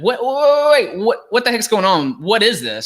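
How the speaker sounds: high, loud mock confusion; confused listener. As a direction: play a baffled new listener. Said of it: "and you're like".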